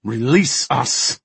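drawn-out, forceful command; demanding, gritted teeth. A narrator's reading. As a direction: order it through gritted teeth. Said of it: "demanded through gritted teeth".

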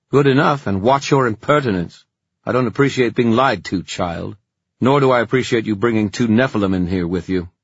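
cold, high and loud rebuke; stern warlock voice. Acted. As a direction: scold them like an imperious elder. Hypatia scolds the children.

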